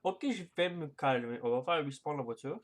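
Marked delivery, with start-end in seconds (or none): none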